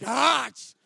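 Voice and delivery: high, loud, laughing shout of delight; retelling his joy at meeting a favourite band, laughing shout; thrilled yelp. Retold or imitated. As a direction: squeal like an overjoyed fan. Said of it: "And I was like".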